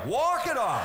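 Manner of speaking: loud mock dad shout, high. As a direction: bark it out like the old man. Dad's stock answer, shouted.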